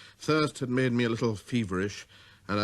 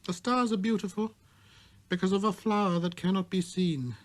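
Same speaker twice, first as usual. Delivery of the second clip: put-on high, earnest boy's voice; little prince; 10 semitones higher than usual. A narrator's reading. Little prince explains children to the switchman, high and earnest. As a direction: speak up like a wise small boy.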